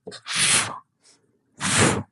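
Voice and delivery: "f" as pure voiceless friction; isolated F sound. Shown as a demonstration. Teacher sounding out letter F, voiceless friction alone. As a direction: blow out "f" with no vowel after it. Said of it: "The next one is F".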